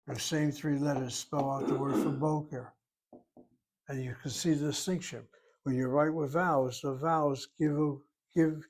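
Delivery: normal talking voice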